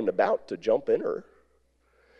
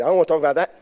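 curt refusal; 4 semitones higher, 7 dB louder, much faster than usual. Retold or imitated. Wife's curt quoted refusal.

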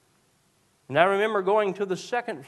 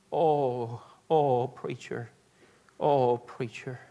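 low, broken moans of grief; a hint of a widow's anguish, half-voiced; grieving widow; 3 semitones lower, much slower than usual. Widow learning of her husband's death, lightly voiced grief.

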